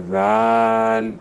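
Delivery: زال drawn out gently and slowly; soft zaal. Shown as a demonstration. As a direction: let زال flow softly. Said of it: "اس کی نرم آواز ہوتی ہے". (نرمی سے زال)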